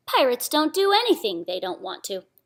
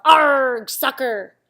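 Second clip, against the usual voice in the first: loud kicking yell; pirate yell; 3 semitones higher, 7 dB louder, much slower than usual. Acted. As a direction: holler as you kick the ball. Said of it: "kicked the ball and yelled".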